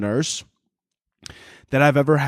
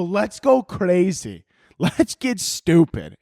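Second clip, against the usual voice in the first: high, very drawn-out party cry; mock party hype; 5 semitones higher, slower than usual. Nurse to friends on a Saturday out, mock party hype. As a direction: stretch it out like a mock rallying call. Acting nurses hyping a night out.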